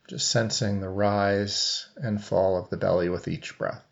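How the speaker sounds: even meditation voice with long silences; guided meditation. Real, not acted. Coach leading seated listeners into breath awareness, guided meditation. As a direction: guide them gently, leaving long pauses between phrases.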